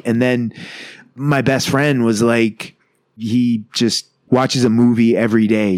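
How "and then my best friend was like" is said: ordinary delivery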